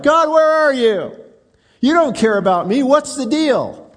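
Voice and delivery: high, loud cry to God, slowed; crying out to God in frustration; retold yell at God. Preacher retelling his night walk, yelling at God. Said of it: "I began to yell out loud at God".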